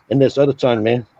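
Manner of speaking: ordinary talk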